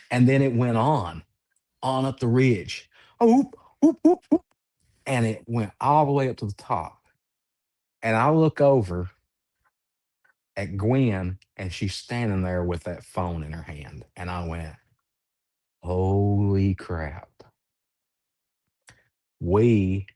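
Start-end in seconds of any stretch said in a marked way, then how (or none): 3.2-4.4 high, loud, short whoops; imitated whoops. Recounting calls climbing the ridge, high imitated whoops. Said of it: "when we were hearing the whoops". 15.8-17.3 slow, drawn-out disbelief; stunned disbelief. Retold or imitated. Recalling seeing Gwen with the phone, slow stunned reaction.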